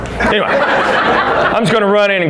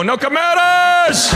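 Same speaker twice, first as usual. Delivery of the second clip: band shouting; 13 semitones higher, slower than usual. Retold or imitated. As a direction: belt "No Chimeras!" out like a band's big hook. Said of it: "And they go".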